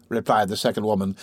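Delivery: ordinary delivery, nothing marked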